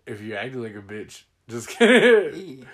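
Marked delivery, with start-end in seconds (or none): 1.5-2.7 drawn out, louder playful take-back; slow teasing just kidding. Real, not acted. Teasing family after the insult, slow and playful. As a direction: stretch it out to soften the jab.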